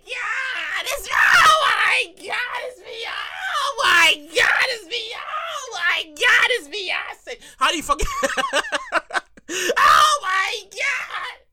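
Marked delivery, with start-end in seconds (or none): 0.0-7.4 slow, high, stunned oh my God; starstruck gasp. Retold or imitated. Starstruck receptionist reacts. 9.8-11.5 overwhelmed gasp. Retold or imitated. Receptionist overwhelmed.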